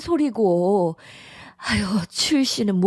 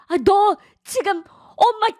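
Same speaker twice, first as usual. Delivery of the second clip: shocked outrage; 7 semitones higher than usual. Acted. Mother reacts to son's threat.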